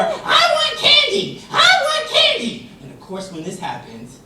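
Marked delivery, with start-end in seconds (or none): 0.0-2.7 put-on high, loud child's wail, drawn out slowly; screaming little girl demanding candy; toddler tantrum. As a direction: throw a tantrum on the church floor. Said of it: "a crying child".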